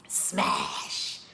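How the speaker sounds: a low breathy whisper. Acted. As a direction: whisper the word like a secret. Playful whispered aside.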